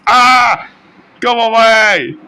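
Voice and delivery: high loud frightened yell; loud high panicked cry; scared yelling. Real, not acted. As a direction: shout in real fright at the enemy. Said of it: "I'm scared".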